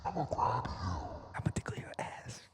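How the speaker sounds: slow, low, distorted whisper. Pretend. Playing with sound effects.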